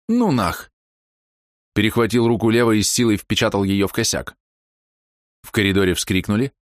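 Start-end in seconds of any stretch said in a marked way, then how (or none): 0.1-0.7 high, sharp defiant blurt; defiant exclamation. Acted. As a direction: spit it out, high and fierce. Crude refusal at gas canister.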